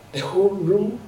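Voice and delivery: normal talking voice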